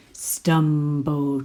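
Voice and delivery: ordinary delivery, nothing marked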